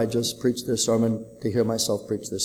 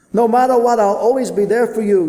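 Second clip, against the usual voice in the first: put-on high, louder boyish echo of the promise; child repeating promise; 11 semitones higher, 10 dB louder than usual. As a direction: give it back like a trusting boy. Son repeats father's promise.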